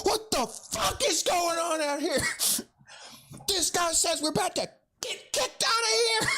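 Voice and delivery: high, strained mock bellow of an enraged coach; imitated coach yell. Coach storming up on his misbehaving players, high mock yelling. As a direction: tear into the team in an acted shout.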